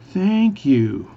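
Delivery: high, loud, stretched syllables; really slow speech. Acted. Newfie thanking the bartender, really slowly. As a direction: draw both words out. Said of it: "is speak really slowly".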